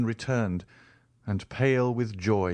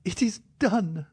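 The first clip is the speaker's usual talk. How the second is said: cried out; 10 semitones higher than usual. A narrator's reading. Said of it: "cried she".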